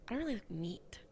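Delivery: whispered like a secret; whispered confession. Real, not acted. Telling viewers she dislikes meat, in a whisper.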